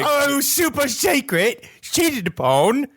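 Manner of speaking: high, slow, wailing Australian-turned-Cockney; bad Australian accent, Cockney-sounding. Ghost father discovering he was betrayed, mock-distraught Australian-Cockney accent. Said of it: "that's Cockney".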